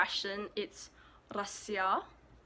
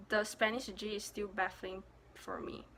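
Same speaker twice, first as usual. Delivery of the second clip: ordinary talk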